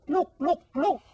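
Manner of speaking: high, eager look look; thrilled call to look; excited calling out. Real, not acted. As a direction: point it out with delight. Excited pointing to picture.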